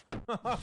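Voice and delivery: high burst of real laughter; delighted laugh. Real, not acted. Vlogger laughing at MJ's comeback to Aunt Anna.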